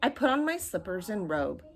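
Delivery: usual speaking voice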